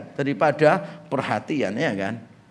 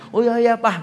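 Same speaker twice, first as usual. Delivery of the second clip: put-on high child's eager agreement; imitated kid's high-pitched yes; eager little son; 6 semitones higher than usual. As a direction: agree like an excited small boy. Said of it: "Apin pasti suka".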